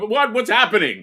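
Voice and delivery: high, loud stammered confusion; hammed-up baffled reaction; mock bewilderment. Acting baffled reaction to imagined video.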